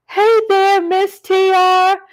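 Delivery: high, loud singsong hello; drawn-out, high-pitched welcome holler; calling greeting. Real, not acted. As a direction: call out slowly to someone just arriving. Greeting a viewer who just joined.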